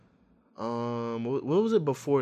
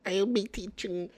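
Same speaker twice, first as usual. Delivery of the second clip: mocking girl voice; 7 semitones higher, 4 dB quieter than usual.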